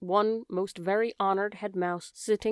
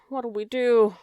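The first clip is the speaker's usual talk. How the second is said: louder, higher wail; 3 semitones higher, 5 dB louder, slower than usual. Acted. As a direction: let the despair break out. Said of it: "wailed Cook".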